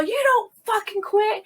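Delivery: high-pitched mock yell; strained, high retold shout. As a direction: imitate a sergeant yelling an order. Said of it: "whole conversation yelling back".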